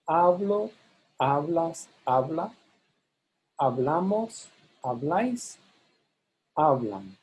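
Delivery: HA stressed in "Hablo, hablas, habla, hablan", BLA in "hablamos, habláis"; stress shift. Shown as a demonstration. Teacher demonstrates for careful listeners, stress shifting to BLA in "hablamos, habláis". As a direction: list each form, shifting weight to BLA for "hablamos, habláis". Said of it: "hear this stress shift".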